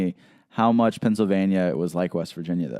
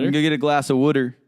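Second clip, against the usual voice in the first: quick, slightly louder imitation Philly accent; 5 semitones higher, 4 dB louder, faster than usual. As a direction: mimic a Philly local asking for water. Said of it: "They say it like that".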